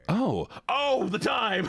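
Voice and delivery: high mock gasp at the time; suddenly realizing it's late; startled. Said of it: "I was like".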